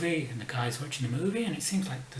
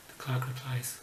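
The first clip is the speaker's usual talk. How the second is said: normal talking voice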